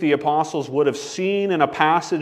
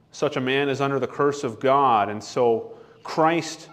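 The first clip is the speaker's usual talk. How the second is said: ordinary talk, nothing marked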